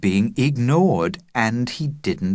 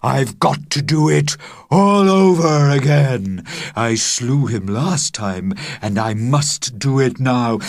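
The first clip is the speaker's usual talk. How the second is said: louder, slow, grim dragon vow; determined cry; 5 semitones higher, 5 dB louder, slower than usual. A narrator's reading. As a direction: declare the vow heavily. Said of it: "cried Dragon".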